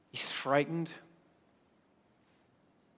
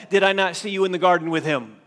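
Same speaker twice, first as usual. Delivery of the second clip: raised, loud, quick challenge; pressing, incredulous accusation; accusing; 4 semitones higher, 7 dB louder, faster than usual. Acted. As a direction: confront him as the eyewitness who caught him. Relative of Malchus accuses Peter.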